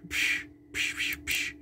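breathy punch sound effects; unvoiced fight noises. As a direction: hiss out the punches.

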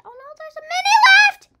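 very loud, high, fast panicked scream. Real, not acted. Child gamer warning viewers a minute is left, screaming. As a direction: scream it as time runs out. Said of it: "sorry if I'm screaming".

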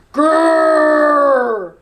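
long rolling lion growl; loud growl. A narrator's reading. The real lion arriving, a loud long growl. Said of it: "a very loud".

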